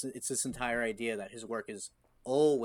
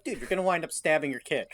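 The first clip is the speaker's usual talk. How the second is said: mock exasperated warning; 6 semitones higher, 6 dB louder, faster than usual. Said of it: "It's like".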